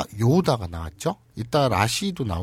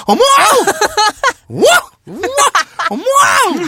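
high, loud gasps of shock; hammed-up, squealing "oh my!" outbursts; big scandalized reaction, way up high; shocked exclamations; 10 dB louder, faster than usual. Acting out startled gossip, delighted.

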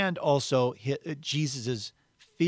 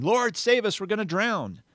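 high, raised-voice cry for help; shouted plea; 9 semitones higher, 4 dB louder than usual. A narrator's reading. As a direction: read it as an urgent shout to be saved.

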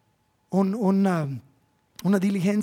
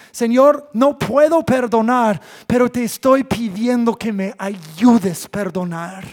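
louder, pleading prayer; earnest plea; 3 semitones higher, 9 dB louder than usual. Pretend. Believer praying to God for help to forgive, louder and pleading. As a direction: cry out honestly to God. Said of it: "puedes llegar al momento en decir".

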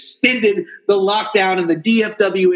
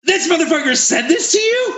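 high incredulous shout; outraged disbelief; 8 semitones higher than usual. Real, not acted. Host reacts to his friend's store story, outraged high shout. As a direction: shriek it, scandalised on your friend's behalf.

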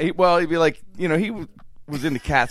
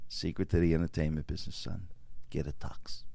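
very low, very soft, sage voice; confiding mentor; 11 semitones lower, 12 dB quieter than usual. Acted. MC advising a young comic on show business, confiding. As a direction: hand down a secret, hushed. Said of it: "he just says".

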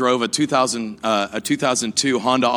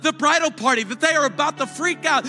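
higher, building sermon climax; preaching at full force; 10 semitones higher than usual. Acted. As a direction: preach it rising to the congregation.

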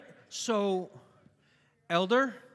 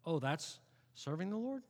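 usual speaking voice

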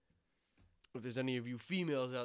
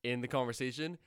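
announcer voice; 6 dB louder than usual. Pretend. Mock announcement about the cut.

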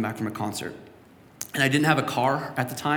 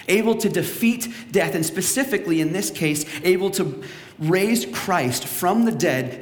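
preaching; 3 semitones higher, slower than usual. Acted. Preaching builds.

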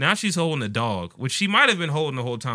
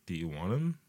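put-on quiet old lady offer; 5 semitones lower, 7 dB quieter than usual. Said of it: "she was like".

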